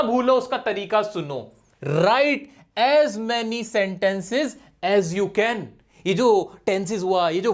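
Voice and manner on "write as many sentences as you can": very slow, word by word; slow dictation. Shown as a demonstration. Teacher dictating the rule to students, very slow. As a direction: spell it out slowly, like a heading. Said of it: "dictating a rule".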